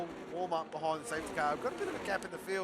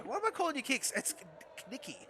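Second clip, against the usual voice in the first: ordinary talk, nothing marked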